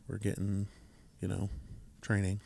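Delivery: low, soft, trailing off without energy; unenthusiastic. Real, not acted. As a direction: say it with zero enthusiasm. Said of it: "You said that with such enthusiasm".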